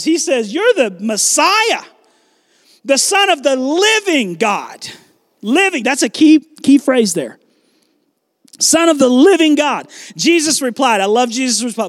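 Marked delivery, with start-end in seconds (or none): 0.5-4.9 slow, high-pitched proclamation; with authority. Acted. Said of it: "Peter says it with authority". 8.6-9.9 raised, emphatic declaration; with authority. Acted. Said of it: "Peter says it with authority".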